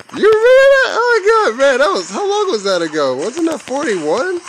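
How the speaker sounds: hammed-up tearful high voice; mock sappy falsetto. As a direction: play a weepy old buddy in falsetto. Mock sappy reminiscing with teammate.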